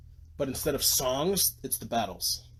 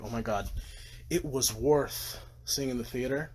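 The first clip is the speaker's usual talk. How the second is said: normal talking voice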